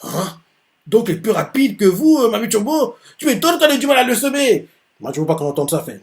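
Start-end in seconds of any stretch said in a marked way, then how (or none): 0.0-4.7 high, startled disbelief; shocked Takakura. Acted. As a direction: blurt it out, stunned. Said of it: "il fait".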